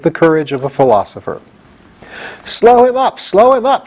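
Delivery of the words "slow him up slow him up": high, loud panicked shout. A narrator's reading. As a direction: yell it in alarm, pitched way up. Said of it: "shouted the Scarecrow".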